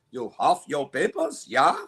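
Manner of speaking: put-on German-accented officer pressing a question. Officer checking a man's papers, German accent. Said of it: "That's a really good German accent".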